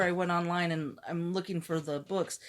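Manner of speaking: ordinary talk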